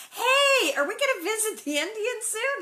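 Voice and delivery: put-on child nagging her parents; eager child. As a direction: bounce in like a nagging kid. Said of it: "Laura keeps bringing it up".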